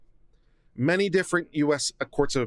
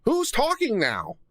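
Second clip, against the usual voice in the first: incredulous; 8 semitones higher, slower than usual. Real, not acted. Stunned reaction to judge's order.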